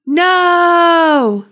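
loud high horrified no; terrified scream. A narrator's reading. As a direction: scream it in horror. Sees friend pulled into vortex.

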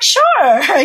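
high, bright mock-sweet sure; overly chirpy compliance; fake cheerful agreement. Answering her stepfather's request for beans, a high mock-sweet sure.

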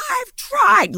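shrieked way up high; mock screaming girlfriend. Girlfriend yelling at her calm boyfriend: high mock shriek. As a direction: wail it like a tantrum. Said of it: "she was like".

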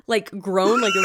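monster groan then high shriek; graboid groan. Pretend. Imitating a graboid noise for co-host, shouted groan. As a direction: rasp out a creature roar. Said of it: "you're so good at that".

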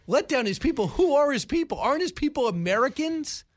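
pitched well up, indignant rhetorical questions; as if he can't believe what he heard; incredulous disbelief. Retold or imitated. Host scoffing at Don Lemon's words, high incredulous questions. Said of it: "I'm thinking to myself".

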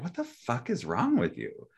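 high-pitched but soft exasperated outburst; mock-mad, incredulous and high; retold anger. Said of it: "getting so mad at him".

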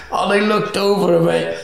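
usual speaking voice

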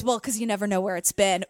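lightly voiced catty dig; shady quip. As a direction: deliver the insult with quiet shade. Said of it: "the shade".

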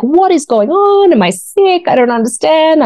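high, alarmed questions to herself; anxious runner asking herself what's wrong; panicked self-talk. Retold or imitated. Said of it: "I'm like".

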